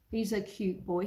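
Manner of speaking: normal talking voice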